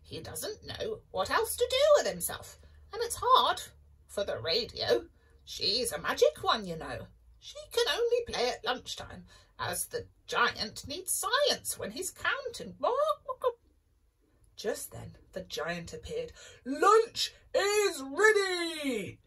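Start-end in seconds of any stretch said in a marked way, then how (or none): 0.0-12.8 high clucky hen; chicken voice. Acted. Chicken speaking. 16.6-19.2 slow loud happy giant; cheerful giant call. Acted. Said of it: "he cried cheerfully".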